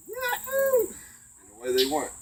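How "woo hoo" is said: very high, loud whoop in another voice; whooping cheer. Retold or imitated. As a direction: let out a wild party whoop. Party cheer heading to hunt.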